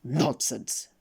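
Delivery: curt, mildly rude denial. A narrator's reading. Mister Uppity contradicting the king, mildly rude. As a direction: dismiss it rudely. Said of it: "said Mister Uppity rudely".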